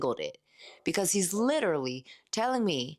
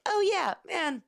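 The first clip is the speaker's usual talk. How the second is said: high, squeaky mock enthusiasm; hammed-up fake delight at a dangled tip; mock fake cheer; 12 semitones higher than usual. Mocking how she should greet a teasing customer, hammed-up squeal. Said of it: "I'm supposed to be like".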